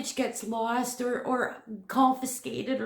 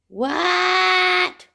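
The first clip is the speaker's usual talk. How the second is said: loud, high, drawn-out disbelief; astonished shout; 5 semitones higher, 8 dB louder than usual. Real, not acted. Vlogger hears something arrive, astonished shout. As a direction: shout it in amazement.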